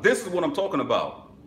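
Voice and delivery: high, loud and excited; hyped up. Real, not acted. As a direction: shout it out with hype. Repeats phrase, second time hyped.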